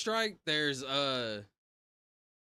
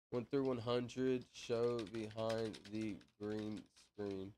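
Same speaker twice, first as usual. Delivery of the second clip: slow drawn-out announcement; 3 semitones lower, 4 dB quieter, much slower than usual. Shown as a demonstration. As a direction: stretch every word very slowly. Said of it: "repeats it drawn out".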